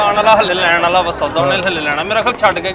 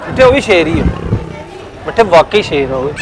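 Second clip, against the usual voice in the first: ordinary delivery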